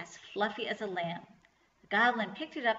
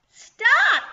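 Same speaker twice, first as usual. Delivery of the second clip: very high, very loud screech; angry screech; 15 dB louder than usual. A narrator's reading. Goblin tripped by the yarn, angry screech. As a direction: screech it out in fury. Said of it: "he screeched angrily".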